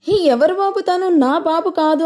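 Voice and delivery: high, startled protest. A narrator's reading. Tarak refusing the baby handed to him, startled and high.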